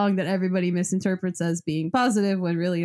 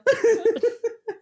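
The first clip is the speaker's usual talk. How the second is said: high laugh; 13 semitones higher, 3 dB louder than usual. Real, not acted. Big laugh at joke.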